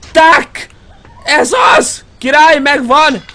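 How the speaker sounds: high loud shouts of victory; triumphant cheering. Real, not acted. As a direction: cheer the win. Triumph after getting item.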